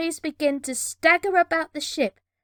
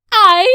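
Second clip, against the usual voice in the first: sudden strangled yelp; choked cry; 7 semitones higher, 15 dB louder than usual. Acted. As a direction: cry out while being throttled.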